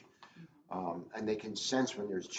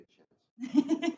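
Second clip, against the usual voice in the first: laughing; 7 dB louder than usual. Real, not acted. Joking about his partner.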